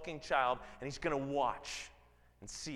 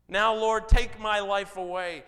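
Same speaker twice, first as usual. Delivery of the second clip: much louder, crying out that he'd rather die; despairing outcry; 5 semitones higher, 12 dB louder than usual. Acted. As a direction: hurl the plea up at God, full voice. Jonah's angry despairing prayer.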